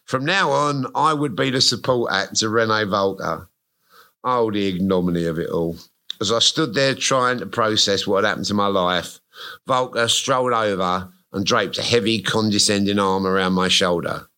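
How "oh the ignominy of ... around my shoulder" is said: normal talking voice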